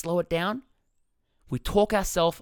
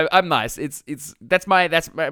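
usual speaking voice

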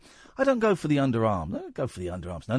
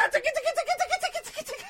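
giggly tickle attack; 4 dB quieter, much faster than usual. Pretend. Tickling the caller on air.

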